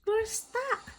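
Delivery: high, sudden cry of alarm. Acted. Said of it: "cried Nila".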